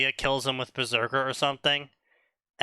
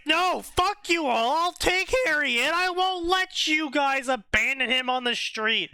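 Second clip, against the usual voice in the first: Sirius's defiant retort; 11 semitones higher than usual. Retold or imitated. Telling off the wizards who'd abandon Harry, pitched-up defiance. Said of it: "Sirius is like".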